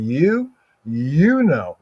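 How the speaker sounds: YOU stretched slowly with a swooping pitch; single out YOU, drawing it out; emphatic YOU. Shown as a demonstration. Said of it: "when you emphasize like you".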